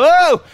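high, loud "oh!"; startled cry. Acted. Crowd sees the mountain shake, startled cry. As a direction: cry out in acted alarm.

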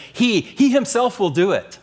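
HE punched high and loud, then repeated; emphatic HE. Shown as a demonstration. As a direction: lean hard on "He, he himself". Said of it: "I like the way it emphasizes".

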